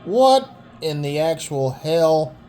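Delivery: loud, slow disbelief; stunned what in the hell; shocked. Real, not acted. Shocked at a wrestling move.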